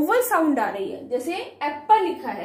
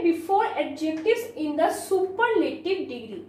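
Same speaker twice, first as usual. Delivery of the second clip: slow dictation; much slower than usual. Shown as a demonstration. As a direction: read the rule word by word. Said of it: "slow dictated rule".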